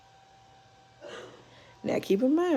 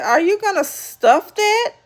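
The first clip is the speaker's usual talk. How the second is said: higher, teasing jab at the raw turkey; mocking question; 11 semitones higher, 3 dB louder than usual. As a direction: tease Jackie about the turkey. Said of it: "And then Shanny said".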